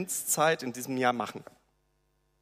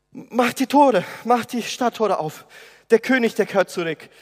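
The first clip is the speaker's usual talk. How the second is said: breathless urgent shouting; urgent panting report; 8 semitones higher, 8 dB louder than usual. Acted. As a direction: call it out, out of breath. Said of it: "atemlos und ruft".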